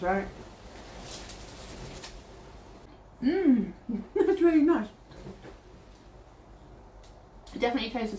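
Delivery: talking while chewing snack; speaking between bites; mouth full, chewing. Real, not acted. Seen 0: Bites snack before, then chews while talking, bites again near 7s.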